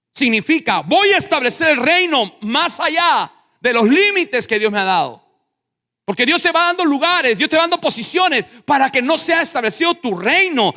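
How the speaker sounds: high, loud, driving preacher shout; full-force preaching. Real, not acted. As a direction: proclaim it at the top of your voice, fast and high.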